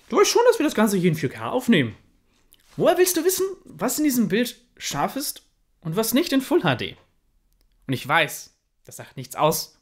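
put-on grumpy old man. Grumpy elder scolds about monitor.

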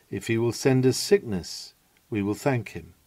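usual speaking voice

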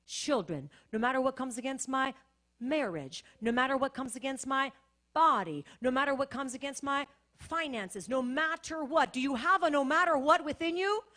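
rally the room like a revival preacher; preaching at full force. Acted. Pastor rousing the congregation to be sold out, loud fervent preaching.

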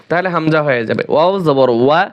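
usual speaking voice

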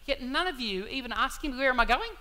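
rising exasperation at the disciples who won't ask; frustrated Jesus. Retold or imitated. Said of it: "I can sense the frustration in him going".

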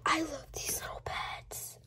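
soft unvoiced whisper of adoration; hushed whisper over tiny pets. Real, not acted. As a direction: breathe it like a secret. Cooing over tiny toys.

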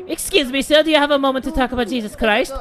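door preacher again. Pretend.

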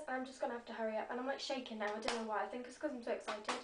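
quiet. Real, not acted.

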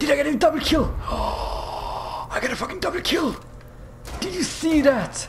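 high, thrilled squeal; very high-pitched, elated outburst; excited disbelief. Real, not acted. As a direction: gasp and cry out in giddy triumph. Celebrating a double kill in-game.

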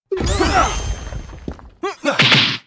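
loud high roar of frustration; frustrated yell. Acted. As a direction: let out an angry howl. Frustrated outburst at gate.

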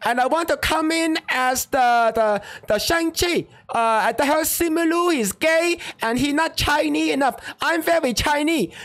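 mock Chinese accent. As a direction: put on an exaggerated Chinese accent to pitch himself for the role. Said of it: "and I talk like this".

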